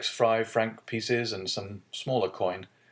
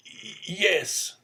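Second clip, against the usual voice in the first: hesitant broken yes, higher; stammering; 10 semitones higher than usual. Acted. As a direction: stumble over the yes like a guilty old man. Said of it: "stammered the old man".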